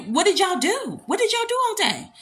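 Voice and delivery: high-pitched disbelieving questions; incredulous. Real, not acted. Host reacting to a commenter never taken out, incredulous.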